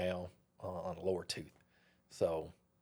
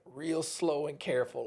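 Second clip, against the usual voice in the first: usual speaking voice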